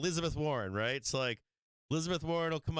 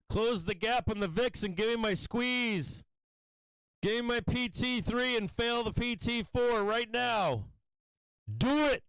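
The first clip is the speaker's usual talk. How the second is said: loud, high shouts urging the market on; shouting at the screen for a squeeze; yelling at the chart; 9 semitones higher, 4 dB louder than usual. Real, not acted. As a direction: cheer the trade up at full voice.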